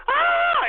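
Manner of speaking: high, loud startled shriek; mock woman's shriek. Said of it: "the women would go".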